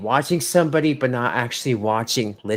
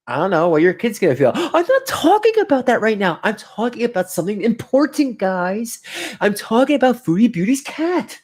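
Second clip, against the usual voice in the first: normal talking voice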